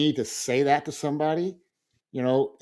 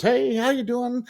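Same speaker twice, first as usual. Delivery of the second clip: high, slow, sing-song hey; breezy greeting; 12 semitones higher, slower than usual. Retold or imitated. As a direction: lawyer greets her client lightly. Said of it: "she goes".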